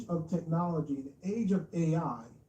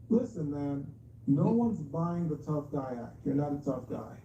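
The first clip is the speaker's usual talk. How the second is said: ordinary talk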